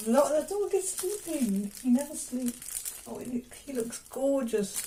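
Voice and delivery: cooing tenderly. Real, not acted. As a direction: coo gently so you don't wake the dog. Cooing over her sleeping dog.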